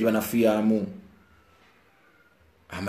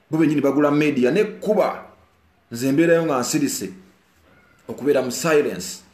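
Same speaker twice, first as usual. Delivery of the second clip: much higher, louder outraged tirade; heated rant; 3 semitones higher, 6 dB louder than usual. Real, not acted. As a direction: vent your anger at them.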